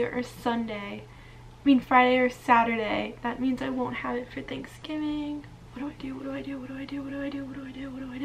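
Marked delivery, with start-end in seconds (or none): 5.7-8.2 panicked muttering. Real, not acted. Panicked quiet repeated muttering.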